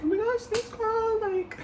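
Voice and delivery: very high, squealed thanks; high-pitched squeaky girly voice; squealing girly thanks. Retold or imitated. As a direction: squeal it back like a flattered best friend. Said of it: "And I was like".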